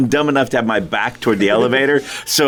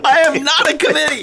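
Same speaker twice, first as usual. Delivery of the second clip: high, indignant movie-quote outburst; mock indignation; 12 semitones higher than usual. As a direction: protest it in a high, mock-outraged voice. Quoting Star Wars in indignation.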